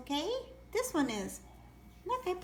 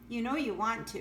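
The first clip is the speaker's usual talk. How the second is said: normal talking voice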